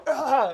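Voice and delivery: abrupt loud, high yelp; jolting cry out of nowhere; sudden outburst. Acted. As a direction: burst out with a startling "Ah!". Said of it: "suddenly someone goes".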